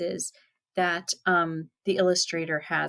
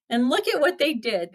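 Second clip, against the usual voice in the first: ordinary delivery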